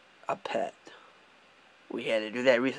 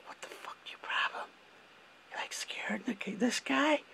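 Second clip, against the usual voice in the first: whispered, mocking disbelief; hushed aside; 10 semitones higher, 11 dB quieter than usual. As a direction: hiss it under your breath at the coworker. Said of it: "It's like".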